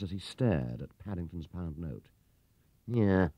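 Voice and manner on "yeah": usual speaking voice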